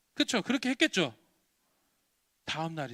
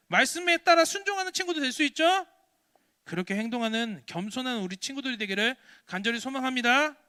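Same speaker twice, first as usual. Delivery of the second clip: fervent preaching; 7 semitones higher, 7 dB louder than usual. Real, not acted. Preacher urging children to obey and love friends, fervent preaching. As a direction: press each question home, loud and high.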